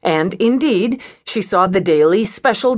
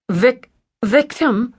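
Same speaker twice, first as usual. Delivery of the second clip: 1 stunned stammer; 7 semitones higher, slower than usual. A narrator's reading. Stunned at the word victim.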